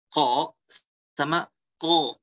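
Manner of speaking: ordinary talk, nothing marked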